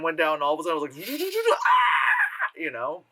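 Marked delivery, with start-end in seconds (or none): 1.5-2.5 high, loud gleeful yell with a laugh; laughing whoop. Real, not acted. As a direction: cheer out with delight. Cheering at the scene.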